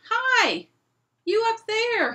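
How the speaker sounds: very loud, high bellow; lion roaring up at a giant; roared. Acted. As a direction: bawl right up at him. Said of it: "he roared".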